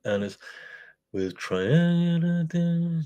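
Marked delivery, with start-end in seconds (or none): 1.1-3.0 high and very slow, hammed-up advert line; mock-singing a Toblerone advert; advert jingle voice. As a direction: croon it like an old TV advert, much higher and slowed right down. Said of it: "there's a Toblerone advert".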